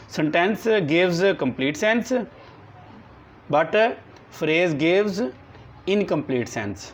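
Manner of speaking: very slow, word by word, for copying down; slow dictation. Shown as a demonstration. Teacher dictating a definition to the class, slow and deliberate. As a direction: dictate each word so the class can write it.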